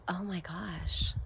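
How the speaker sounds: breathy whisper of a private thought; slow, hushed aside to herself. Retold or imitated. Tired homemaker wishing for a wife, whispering to herself.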